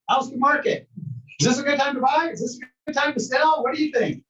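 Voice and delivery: high, bright-eyed client firing off questions; eager client questions. Retold or imitated. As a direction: play a keen buyer quizzing the agent. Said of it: "number one question people are gonna ask you".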